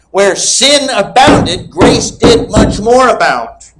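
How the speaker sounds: high, slow and emphatic second reading; repeated slow proclamation. Real, not acted. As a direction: say it again to rouse a thunderous amen. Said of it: "I'll say it again".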